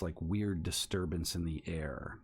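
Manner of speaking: ordinary delivery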